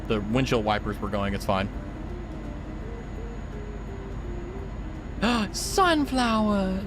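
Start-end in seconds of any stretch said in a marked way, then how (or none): 1.7-5.5 awed gasp. Real, not acted. Sees sunflowers in game. 5.5-6.8 slow, high, wonderstruck exclaim; delighted awe. Real, not acted. Spots sunflowers while driving.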